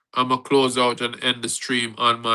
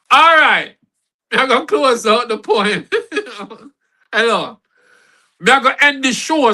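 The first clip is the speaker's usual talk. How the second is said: excited; 9 semitones higher, 5 dB louder than usual. Real, not acted. Energetic wrap-up call.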